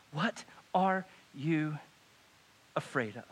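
measured, probing question. Acted. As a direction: pause between the words, unhurried. Jesus's question, word by word.

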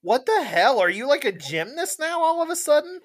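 incredulous, almost squeaking; surprised. Real, not acted. Friend reacting to a cartwheel boast, high incredulous squeak. Amazed at gymnast claim.

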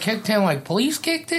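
high, disbelieving squeak; high-pitched incredulous check; incredulous question. Real, not acted. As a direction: ask it in shocked disbelief. Friend asking in disbelief.

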